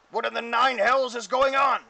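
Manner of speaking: loud, high, baffled yell; outraged orc shout. Acted. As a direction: demand it as a bewildered orc. Game master voices alarmed adult orcs.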